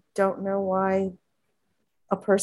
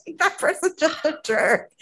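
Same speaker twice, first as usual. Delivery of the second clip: high, laughing verdict; amused, higher-pitched put-down; 9 semitones higher than usual. Real, not acted. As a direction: say it through a laugh. Laughs while judging the critic.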